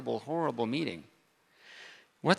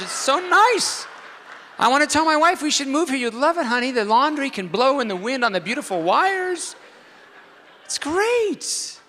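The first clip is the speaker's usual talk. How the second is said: sarcastic gushing; 8 semitones higher, 8 dB louder than usual. Pretend. Mock raving about laundry wires.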